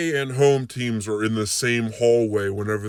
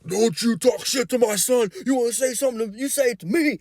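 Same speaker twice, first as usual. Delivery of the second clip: angry dad; 13 semitones higher, faster than usual. Retold or imitated. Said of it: "that kid's dad was like".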